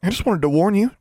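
high-pitched mock-innocent voice; falsely sweet, innocent protest; feigned innocence. A narrator's reading. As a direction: act the innocent, pitched well up. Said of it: "in a tone of feigned innocence".

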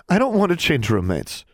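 put-on high-pitched girl's protest; pleading girl. A narrator's reading. Girl refusing to leave her roommate, pleading. Anna protests being separated from friend.

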